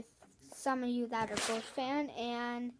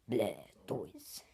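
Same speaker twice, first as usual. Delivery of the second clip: a much lower, growled creature call; Blastoise sound; 14 semitones lower than usual. Shown as a demonstration. Kid showing viewers his Blastoise sound, deep and growled. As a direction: growl the name deep like a big turtle. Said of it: "This is my Blastoise sound".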